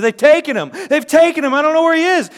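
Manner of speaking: loud, high, panicked: they've taken him; a frantic woman running to the disciples; alarmed cry. Acted. Mary panicking to Peter and John.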